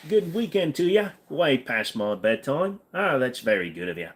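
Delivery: put-on playful Aussie accent that starts high and eases down. Streamer keeps chatting to chat in an Australian accent. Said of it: "It's a very decent Aussie accent".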